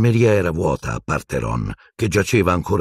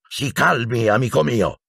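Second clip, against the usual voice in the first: higher-pitched, brusque appeal; barked order; 6 semitones higher than usual. A narrator's reading. Minister telling the professor to calm down, barking. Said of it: "abbaiò Fudge".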